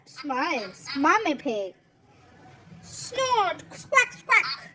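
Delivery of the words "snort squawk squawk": animal noises. Acted. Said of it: "says George".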